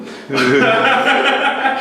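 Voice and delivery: a high, bashful burst of laughter; embarrassed laugh. Real, not acted. Embarrassed about showing the video.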